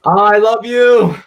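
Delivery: high, drawn-out adoring coo; slow, gushy outpouring of love; gushing affection. Real, not acted. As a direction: stretch it out high with open affection.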